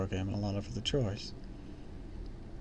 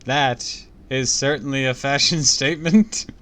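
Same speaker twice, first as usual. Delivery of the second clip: laughing; 4 semitones higher, 6 dB louder than usual. Real, not acted. Reader laughs at description.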